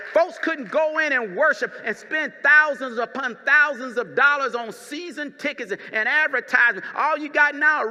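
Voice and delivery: loud, fervent pulpit preaching. Acted. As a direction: preach it out full force to the church. Pastor preaching to congregation.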